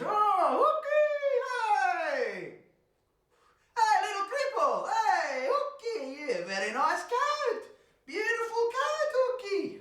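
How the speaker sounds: very high, sing-song sugary greeting; fake-sweet butcher. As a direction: lure him in with false charm. Butcher feigns friendliness.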